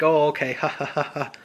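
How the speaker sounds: loud retold laughing okay; imitating her laugh. Retelling how the client laughed at his promise. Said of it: "she kinda starts laughing".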